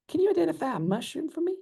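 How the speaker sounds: high, quick Southern lady voice. Retold or imitated. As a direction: put on a high Southern accent. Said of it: "Love doing the Southern talk".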